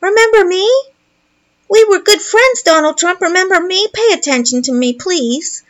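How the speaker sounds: put-on high whining little boy; whiny needy child. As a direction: beg for attention like a spoiled boy. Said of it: "That's what he's saying".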